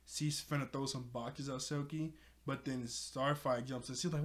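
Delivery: ordinary delivery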